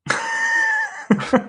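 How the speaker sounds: a sudden high laugh bursts out; burst of laughter. Real, not acted. Co-host laughs at the question.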